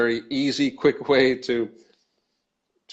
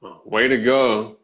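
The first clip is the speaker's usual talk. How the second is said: slow, sarcastic way to go; 4 dB louder, slower than usual. Shown as a demonstration. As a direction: drag it out in mock praise. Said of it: "in a sarcastic tone of voice".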